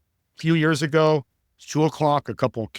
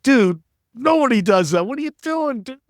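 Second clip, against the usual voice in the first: surfer dude; 11 semitones higher, 6 dB louder than usual. Retold or imitated. Clerk to the customer, a stunned surfer dude voice. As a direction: play the stunned long-haired clerk. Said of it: "surfer dude".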